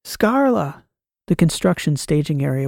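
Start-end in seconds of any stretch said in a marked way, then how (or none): 0.0-0.8 high, urgent call across rubble. Acted. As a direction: call her name, searching. Calling for lost companion.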